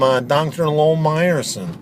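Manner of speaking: usual speaking voice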